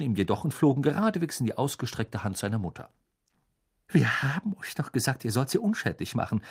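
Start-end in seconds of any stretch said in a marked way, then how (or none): none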